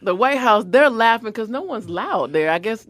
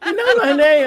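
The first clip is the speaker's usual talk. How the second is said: giddy laughing delight; 7 semitones higher, 6 dB louder than usual. Retold or imitated. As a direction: laugh it out star-struck. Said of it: "And I was".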